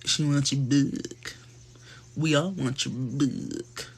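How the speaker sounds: slow breathy whispered Cole line; Cole quote. Retold or imitated. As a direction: sussurrate it slowly like a creepy come-on. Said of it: "he was like".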